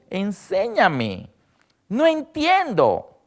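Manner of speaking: very slow, rising pleading question; humble plea. Retold or imitated. Daniel asking God to teach him, slow humble plea. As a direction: beg humbly to understand.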